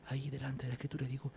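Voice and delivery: usual speaking voice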